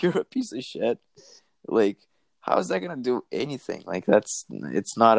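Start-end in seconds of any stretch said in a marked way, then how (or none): 0.0-1.0 much higher, kiddish voice; a lightly hammed-up kid taunt; mock rookie insult. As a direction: squeak it like a twelve-year-old. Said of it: "is like".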